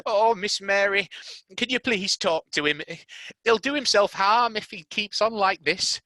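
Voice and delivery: high, loud desperate plea; pleading nurse. Acted. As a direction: beg for help.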